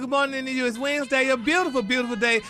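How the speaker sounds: upbeat morning-radio hello; radio greeting. Acted. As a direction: greet the whole country big. Opens his morning segment.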